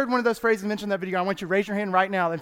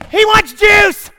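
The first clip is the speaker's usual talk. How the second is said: much higher, slow, loud shout from afar; brother yelling; 12 semitones higher, 14 dB louder, much slower than usual. Retold or imitated. Big brother answering for him from far off, shouting. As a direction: holler it from six doors down. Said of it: "And you heard him".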